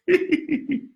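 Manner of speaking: a short high-pitched laugh; high giggle. Real, not acted. Coach laughing at the absurd cold call idea. Laughing at his own question.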